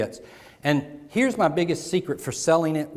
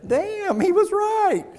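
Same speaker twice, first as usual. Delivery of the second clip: high, stunned "Damn, he was right"; surprised realization; much slower than usual. Pretend. Audience member realizing speaker was right, high surprised voice. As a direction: squeak it out like a sudden discovery. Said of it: "You're gonna say".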